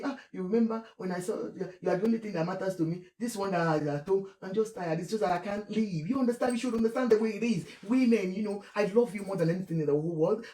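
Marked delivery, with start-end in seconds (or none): 0.0-6.1 much softer, fast secretive murmur; whisper-soft sweet talk to a girlfriend; hushed lover's murmur. Retold or imitated. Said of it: "And they will say". 8.8-10.5 much softer, fast lovesick murmur; hushed sweet talk. Retold or imitated. Imitating husband on phone.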